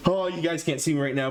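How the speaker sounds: ordinary delivery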